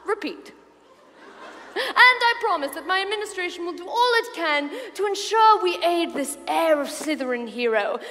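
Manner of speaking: usual speaking voice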